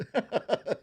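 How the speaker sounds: quick amused laugh; short laugh. Real, not acted. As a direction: laugh at the funny guess. Amused by the guess.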